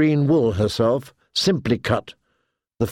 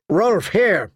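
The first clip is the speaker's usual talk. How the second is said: shouting; 7 semitones higher than usual. A narrator's reading. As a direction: shout to a man across the room. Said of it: "she shouted down".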